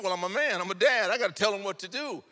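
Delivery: hammed-up macho declaration, loud and high; mock bluster. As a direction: play the self-important man of the house. Boasting to wife, loud.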